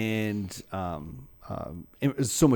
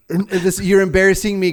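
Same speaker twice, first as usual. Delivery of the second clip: higher, louder embarrassed protest; embarrassed Adam; 8 semitones higher, 7 dB louder than usual. Acted. Adam objecting to God's talk, loudly embarrassed. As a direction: play a mortified kid complaining to a parent. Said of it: "picturing Adam too being like".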